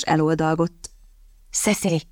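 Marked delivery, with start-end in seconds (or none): 1.5-2.0 softly hissed name; hushed, scolding hiss; quiet hiss. A narrator's reading. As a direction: hiss her name under your breath. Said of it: "sziszegte halkan Kitty".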